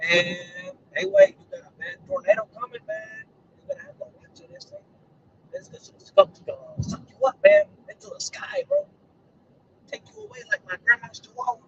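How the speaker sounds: hushed, high-pitched mock Spanish accent. Vlogger copying them, warning of tornado, mock Spanish accent. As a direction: warn softly in a put-on Spanish accent. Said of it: "I start talking just like them".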